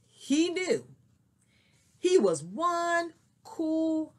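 lively storybook reading; story narration. Acted. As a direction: read it like a picture book to kids. Reads a picture book aloud.

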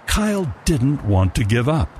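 normal talking voice

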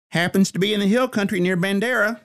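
high Texas twang, hollered back. A narrator's reading. Secretary hollers back to the captain in Texas twang. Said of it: "In her twang, she hollered back".

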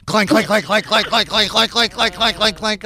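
rapid-fire mimicked pen banging; imitated clanking. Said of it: "she's over there going".

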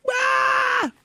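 sudden high shout of alarm; startled yell. Retold or imitated. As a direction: yelp as if jolted into action. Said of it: "And I'm like".

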